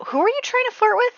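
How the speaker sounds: very high-pitched, quick squeal of disbelief; mock scandalised. Player catching a Sim flirting, high mock-scandalised demand. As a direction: squeak it out in playful outrage.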